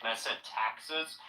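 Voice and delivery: ordinary talk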